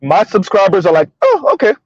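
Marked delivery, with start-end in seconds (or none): 1.2-1.7 high-pitched, imitated realization; mock pleasant surprise. Host voicing his subscribers' reaction, mock pleasant surprise. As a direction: voice a fan catching on, pitched way up.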